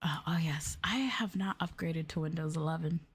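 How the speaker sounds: normal talking voice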